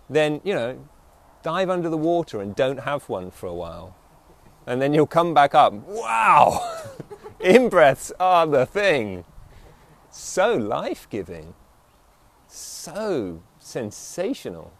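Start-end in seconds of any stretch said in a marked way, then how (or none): 5.7-9.2 amazed gasp of relief. Pretend. Coming up from under water.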